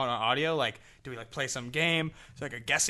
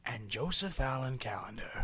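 softer trailer announcer; movie trailer voice; 7 dB quieter than usual. Pretend. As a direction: intone it like a trailer. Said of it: "nineties movie trailer voice".

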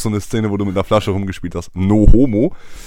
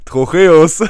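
ordinary talk

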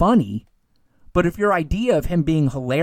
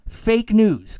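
sarcastic fake news; 4 semitones higher, 4 dB louder than usual. Catchphrase repeated sarcastically.

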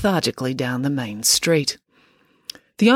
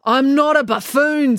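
snapping, higher and louder London bobby; london policeman; 9 semitones higher, 5 dB louder than usual. Acted. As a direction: snap it back as a London constable, slow. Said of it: "snapped Constable Pike".

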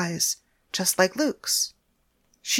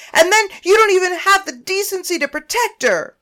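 much higher, louder raging yell; furious screaming; 10 semitones higher, 11 dB louder than usual. Acted. Naomi screaming accusations at Paris, furious. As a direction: scream every word at her.

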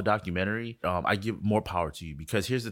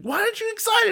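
much higher, squeaky mock-disbelief; mock incredulous friend; 7 dB louder than usual. A friend pressing him on his calm, very high mock disbelief. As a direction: squeak it like a friend who can't believe it. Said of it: "friend quoted asking him".

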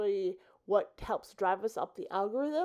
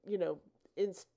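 ordinary talk

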